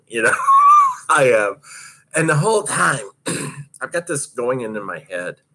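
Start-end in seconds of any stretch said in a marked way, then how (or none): 0.4-1.1 short high laugh; burst of laughter. Real, not acted. Laugh after dying remark.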